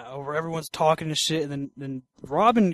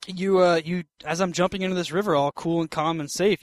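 ordinary talk

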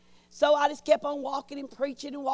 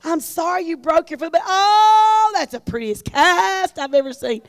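louder, higher cooing delight; gushing admiration; 3 semitones higher, 8 dB louder than usual. Retold or imitated. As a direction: gush over the pretty cast. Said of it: "everybody go".

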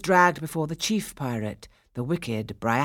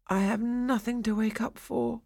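higher-pitched despondent mutter; 8 semitones higher than usual. A narrator's reading. Mourning Daphnis answering the shepherds, muttered. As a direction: mutter it without hope. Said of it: "he muttered".

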